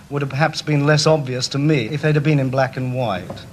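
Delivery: ordinary talk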